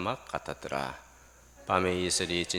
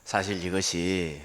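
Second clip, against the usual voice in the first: ordinary delivery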